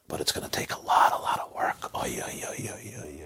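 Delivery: breathy whisper, sighing over the work; whispered dismay. Acted. Craftsman to the man over the vessel, whispered dismay. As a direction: hush it like a weary craftsman tutting.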